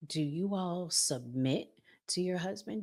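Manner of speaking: ordinary talk, nothing marked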